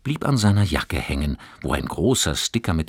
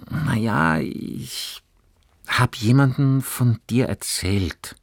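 embarrassed; 7 semitones higher, slower than usual. A narrator's reading. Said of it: "sagte Johannes sichtlich verlegen".

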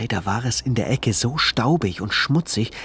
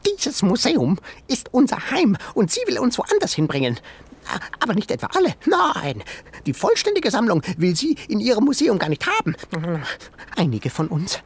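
high, fast, distraught animal voice; tiny creature voice; 10 semitones higher, faster than usual. A narrator's reading. Sengi telling Ben their fate, high creature voice. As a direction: rush the doom like a panicked critter.

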